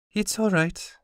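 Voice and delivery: voiced high like a young girl, gentle; high girl's voice. Girl reassures the grieving craftsman, high and gentle.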